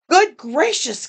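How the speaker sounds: high gasp of surprise, lightly acted; a startled little gasp; lightly voiced surprised bird. A narrator's reading. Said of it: "gasped Maisie".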